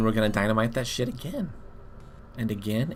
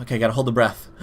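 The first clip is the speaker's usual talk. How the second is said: ordinary delivery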